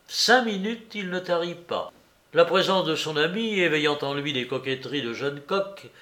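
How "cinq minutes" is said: plain reading voice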